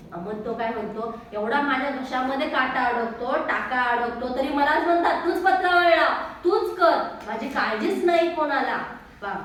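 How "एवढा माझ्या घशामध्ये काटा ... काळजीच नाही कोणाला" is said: put-on angry child complaint. Boy complains angrily.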